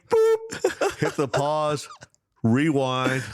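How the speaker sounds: high squeaky boop, then a bright call to rewind; playful rewind sound. Pretend. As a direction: play it like a tape pausing and rewinding.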